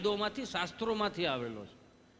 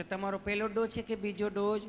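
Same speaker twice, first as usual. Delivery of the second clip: lightly raised, gently questioning nurse voice; easy, affectionate nurse questioning; sweet nurse. Acted. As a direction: ask lovingly, like a nurse. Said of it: "nurse બેને સહજતાથી જેમ પૂછતા હતા".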